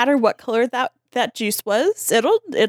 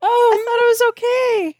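timid high protest; 12 semitones higher, 3 dB louder than usual. Retold or imitated. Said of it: "And Chris is like".

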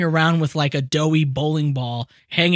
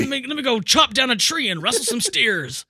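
pitched-up rugged boast; mountain man voice; 6 semitones higher than usual. Retold or imitated. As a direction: brag like a cowboy. Continues mountain man bit.

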